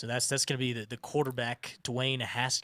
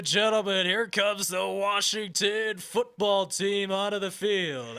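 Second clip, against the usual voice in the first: announcer voice; 7 semitones higher, 4 dB louder than usual. Pretend. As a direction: boom it out like a PA announcer. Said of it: "do it in your announcer voice".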